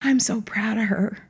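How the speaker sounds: real choked-up voice, soft and fading towards a whisper; emotional. Real, not acted. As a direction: tell it softly while fighting back tears. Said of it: "Makes me so emotional".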